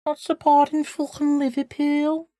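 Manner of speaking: high-pitched put-on Scouse accent; Liverpool accent. Streamer mocking non-Liverpool fans, high Liverpool accent. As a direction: do a squeaky-high mock Liverpudlian. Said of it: "I do Liverpool".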